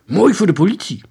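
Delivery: high, loud enthusiasm; eager exclamation. A narrator's reading. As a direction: call it out eagerly. Children cheer the evidence. (luid, enthousiast geroep)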